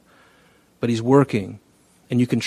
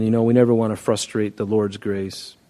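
normal talking voice

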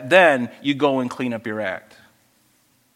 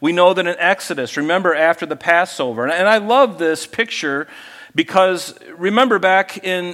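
ordinary delivery

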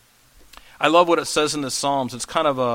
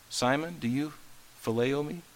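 gentle asking; 2 semitones lower, 9 dB quieter than usual. Acted. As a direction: ask it softly, almost hurting. Jesus gently asks third time.

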